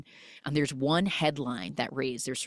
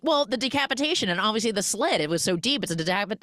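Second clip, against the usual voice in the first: imitating matter-of-fact rattling off, higher and quick; blunt; 6 semitones higher, faster than usual. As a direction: rattle off grim details as if it were nothing. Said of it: "absorbing this blunt information".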